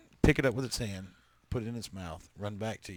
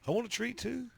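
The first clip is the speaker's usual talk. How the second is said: much higher, pleading little pet voice; begging cat voice; 12 semitones higher than usual. Pretend. Cat that acts like a dog asking for a treat, high and pleading.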